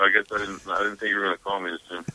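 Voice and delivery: ordinary talk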